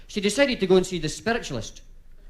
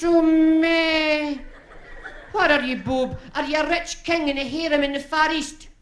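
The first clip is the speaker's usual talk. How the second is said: high falsetto wifey; 10 semitones higher, slower than usual. Acted. Wife voice, high.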